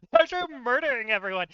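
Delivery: laughing disbelief. Real, not acted. Telling viewers the players killed everyone, laughing. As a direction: crack up at how absurd it got.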